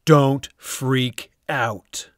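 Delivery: usual speaking voice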